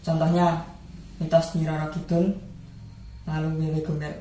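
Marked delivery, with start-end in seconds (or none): none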